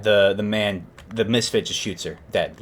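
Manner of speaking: ordinary talk, nothing marked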